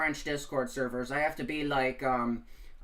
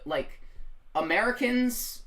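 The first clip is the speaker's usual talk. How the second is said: usual speaking voice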